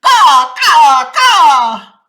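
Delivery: loud, high alarm caws; crow caw. A narrator's reading. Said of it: "flew into the forest crying".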